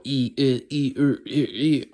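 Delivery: one syllable E on changing Mandarin-style tones; different tones. Shown as a demonstration. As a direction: give each "E" its own pitch contour. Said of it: "are all different tones".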